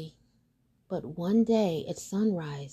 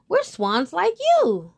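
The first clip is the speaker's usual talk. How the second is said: much higher, slow and louder swan voice; swans greeting; 10 semitones higher, 8 dB louder, slower than usual. Acted. Swans welcoming the duckling, high, loud and slow. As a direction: call out warmly, high and slow. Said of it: "they said".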